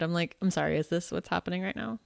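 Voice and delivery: normal talking voice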